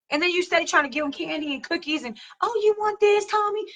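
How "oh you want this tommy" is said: high, sugary coaxing parent voice; mock sweet parent. Indulgent parent offering candy to misbehaving child, high and sugary. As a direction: coo at the child like a doting parent.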